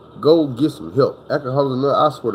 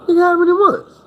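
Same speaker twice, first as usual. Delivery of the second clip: much higher, squeaky disbelief; as if he just got caught off guard; incredulous question; 4 dB louder than usual. Retold or imitated. Friend questions how far along, high disbelief.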